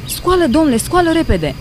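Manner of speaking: high, urgent wake-up call; scared nun waking the man beside her, high and urgent; frightened. A narrator's reading. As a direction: shake him awake in a panic. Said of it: "speriată".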